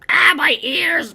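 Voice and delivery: high, loud, stretched pained cry; pained yelp. Acted. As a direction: yelp at a piercing noise. Shrill whine hurts ears.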